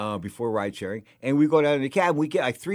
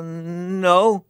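mock unsure; 5 semitones higher, 4 dB louder than usual. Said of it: "I really didn't know what to say".

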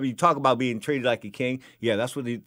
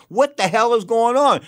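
high, loud exasperated demand; retold indignant outburst; 10 semitones higher, 7 dB louder than usual. Customer challenges the water lady, retold indignant outburst. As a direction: demand an answer, replaying the outrage. Customer protests price rise.

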